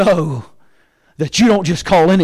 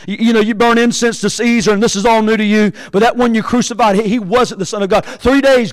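usual speaking voice

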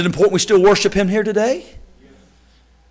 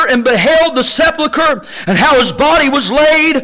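preaching scripture; 4 semitones higher than usual. Acted. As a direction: proclaim the verses to the congregation. Reading scripture at full force.

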